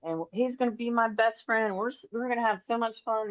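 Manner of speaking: put-on lightly childlike eager voice; a hint of a thrilled little boy; voicing the excited baby. Medium relaying the baby's excitement, lightly childlike. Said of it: "So she said".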